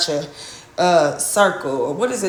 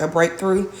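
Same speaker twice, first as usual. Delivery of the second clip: ordinary delivery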